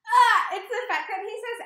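loud, high gush of feeling. Real, not acted. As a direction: burst out with it. Fan gushing.

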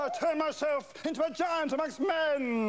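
big boastful announcement; grand boast. Acted. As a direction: proclaim like a giant. Boasting giant transformation.

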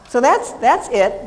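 ordinary talk, nothing marked

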